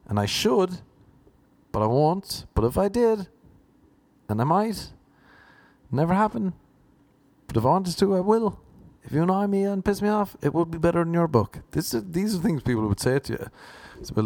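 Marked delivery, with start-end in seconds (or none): 0.1-11.6 high-pitched, blustering one-upmanship; mock-jealous bragging in a raised voice; defensive boasting. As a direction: insist ever higher, backing yourself. Acts jealous would-be author boasting.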